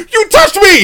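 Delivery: very high mock-outraged cry; outraged God. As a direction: yelp it like a touchy deity. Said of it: "And God goes".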